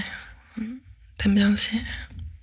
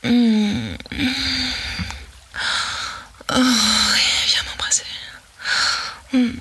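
rising ecstasy; 3 semitones higher than usual. Acted. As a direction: let it climb until you cry out. Roleplay building to climax.

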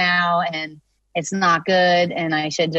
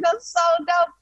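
high, laughing "That's so dope"; laughing excitement; 11 semitones higher than usual. Retold or imitated. Songwriter quoting the studio's reaction, high laughing delight. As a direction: giggle it out, thrilled.

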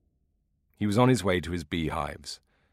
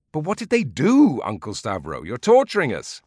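much higher and louder, exasperated begging; impatient pleading; 6 semitones higher, 8 dB louder than usual. Acted. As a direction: beg him to finish. Listeners beg for ending.

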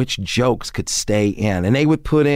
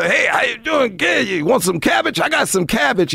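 Redd Foxx voice; 9 semitones higher than usual. Retold or imitated. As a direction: call out to the kid, showing off the cash. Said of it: "He go".

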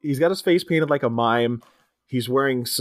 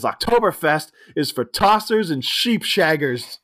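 hammed-up British sneer; 4 semitones higher than usual. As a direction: sneer it like a cocky English player. British player scoffing.

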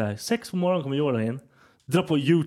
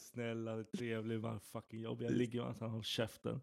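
low, very soft mock inner monologue; resigned muttering; 5 semitones lower, 10 dB quieter than usual. Woman talking herself into tolerating a man, resigned muttering. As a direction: mumble it like a reluctant concession. Voicing reluctant women's inner reasoning.

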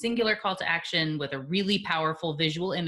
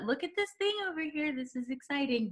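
mock excitement; 10 semitones higher, 6 dB quieter than usual. Mock overeager cat call.

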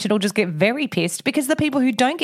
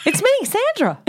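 protesting owner; 12 semitones higher than usual. Retold or imitated.

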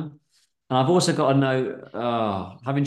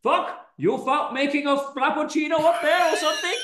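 much higher shouted Indian voice; mock shout; 14 semitones higher than usual. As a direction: yell it, hammy and high. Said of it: "gonna plump on Indian".

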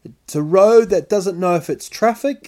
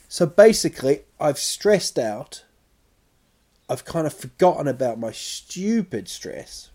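ordinary talk, nothing marked

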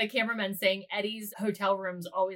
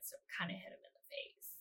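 whisper; 3 semitones lower, 20 dB quieter than usual. Real, not acted. Confessing she hit the cameraman.

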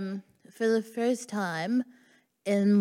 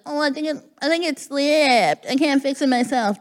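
higher, louder put-on helpless plea; feigned helplessness; 6 semitones higher, 5 dB louder than usual. Performer angling for back touches, feigned helplessness. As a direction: play helpless and make a show of it.

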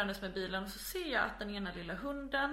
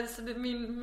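ordinary talk

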